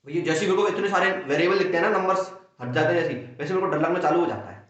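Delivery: usual speaking voice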